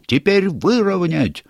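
high, slow hoarse yell. Acted. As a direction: keep yelling hoarse orders. Gnome leader yelling steering orders.

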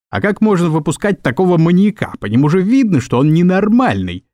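lightly acted indignation at a madman being released; mild outrage.

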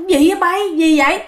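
sharp high startled ask; grandma jolts up asking what's going on; alarmed question. Retold or imitated. Grandma startled by mother's outburst, sharp high question.

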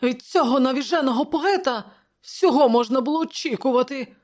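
high-pitched, loud grumbling; angry. A narrator's reading. Counsellor railing about the mad poet, angry. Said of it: "мовив Публій сердито".

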